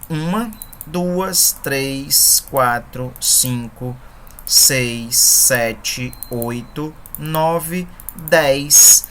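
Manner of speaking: numbers said slowly, one by one; counting syllables aloud. Shown as a demonstration. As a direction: count out each poetic syllable with pauses. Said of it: "A quantidade de sílabas nós vamos ver agora". (contagem pausada das sílabas)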